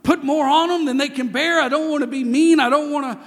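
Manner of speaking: mock tearful plea, high and loud; anguished father quoted. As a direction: play the torn father, voice up high. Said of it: "he said".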